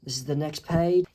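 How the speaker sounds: ordinary delivery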